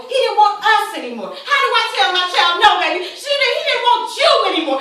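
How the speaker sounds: loud, high, desperate cry; anguished outburst. Acted. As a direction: cry out in hurt at being abandoned. Mother's anguished outburst over abandonment.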